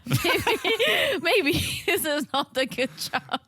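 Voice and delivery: laughing through the words; can't help giggling while saying it; amused, breaking into laughter. Real, not acted. Laughing over the show's awkwardness.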